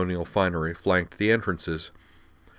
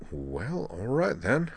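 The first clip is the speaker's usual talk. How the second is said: soft, uncertain giving in; resigned; 3 semitones higher, 6 dB quieter, slower than usual. Acted. Devlin giving in before the doors, soft and hesitant. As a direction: give up the argument.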